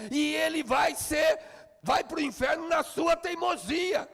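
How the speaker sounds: gritado, bem mais agudo e mais alto; pregação a plenos pulmões. Acted. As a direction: martelando cada frase aos brados. Preaching at full force. (pregador gritando com toda a força)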